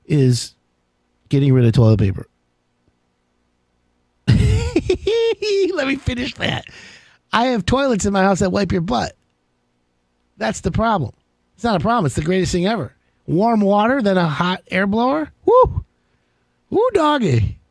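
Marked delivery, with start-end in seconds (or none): 4.3-6.7 laughing. Real, not acted. Laughing through his own joke. 15.5-17.6 excited whoop. Real, not acted. Host whooping to listeners over his fancy toilet, high excited yelp.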